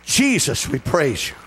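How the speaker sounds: loud, high exultant preacher's prayer; full-force praise. Acted. Pastor leading the congregation in thanks to God, full-force praise.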